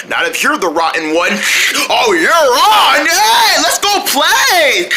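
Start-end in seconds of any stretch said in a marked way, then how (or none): 1.9-4.9 slow, high, loud eager call; eager shout. Acted. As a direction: accept the dare gleefully. Eager challenge to race.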